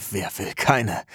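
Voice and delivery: kurz aufschnauben, dann langsam die Frage; verächtlich lachend abwinken; schnaubendes Lachen. Acted. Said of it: "Ich schnaube". (mit kurzem Schnauben lachend)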